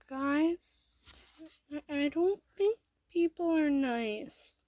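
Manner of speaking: put-on high, tearful child voice, hesitant start; small hurt child. A narrator's reading. Crying kid telling the turtles after school, upset. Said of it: "you quietly say".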